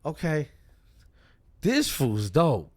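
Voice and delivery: re-enacted loud, impressed reaction; impressed approval. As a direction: savor each verdict, won over. Re-enacting being impressed by a rapper.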